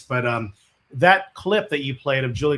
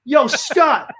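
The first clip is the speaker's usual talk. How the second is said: hype stage shout; 14 semitones higher, 8 dB louder than usual. Retold or imitated.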